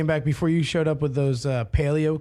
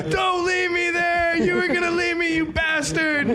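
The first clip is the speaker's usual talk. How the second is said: Jim; 10 semitones higher than usual. Retold or imitated. Jim sprinting after friends who left him, mock desperate yell. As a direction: holler it high, stretching the words. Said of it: "It's Jim sprinting down the street".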